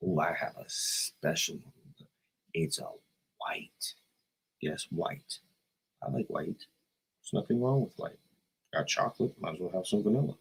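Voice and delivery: soft, low, confiding tease; hushed reveal. Real, not acted. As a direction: let a prized secret out quietly. Teasing a special secret pepper.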